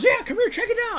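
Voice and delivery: very high, laughing eager call; bursting to show off his find; giddy excitement. Retold or imitated. Teen friend waving him over to see the car, giddy. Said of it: "And he's all excited".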